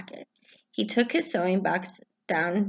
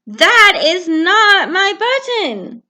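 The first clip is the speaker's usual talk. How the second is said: much higher, louder, slow, angry; shouting Toad; 11 semitones higher, 8 dB louder, much slower than usual. Acted. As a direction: yell it, fed up. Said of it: "shouted Toad".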